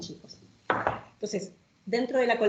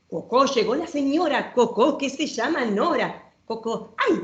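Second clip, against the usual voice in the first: playful, sing-song read-aloud with bouncing rhyme; reading to children; 4 semitones higher than usual. Acted. Showing a children's book to the audience, lively storytime reading.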